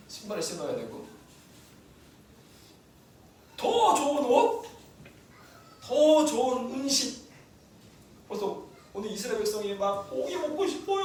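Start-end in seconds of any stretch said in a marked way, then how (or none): 3.6-7.3 high, slow, drawn-out wanting; emphatic craving. Acted. Preacher mocking the people's endless wants, emphatic. 10.1-11.0 put-on high childish whine for meat; whining people. The Israelites begging Moses for meat, whining.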